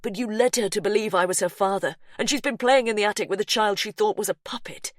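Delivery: insist, unyielding; firm insistence. Acted. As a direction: brother insisting the child needs help. Brother insists.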